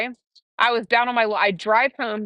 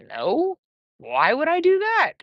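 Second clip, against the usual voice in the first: high, swooping retort; acted-out baffled dismissal; mock incredulous refusal; 8 semitones higher, slower than usual. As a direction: swoop the pitch up as if the idea is absurd. Retold reaction to caller's suggestion.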